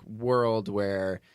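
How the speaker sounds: ordinary talk, nothing marked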